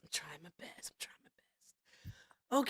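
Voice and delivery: unvoiced whisper of "I'm trying my best"; defeated whisper. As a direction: breathe the line with no voice at all.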